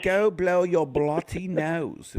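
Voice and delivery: slow, lightly put-on British accent; a hint of a Brit scolding, drawn out. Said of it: "maybe this would be the last one".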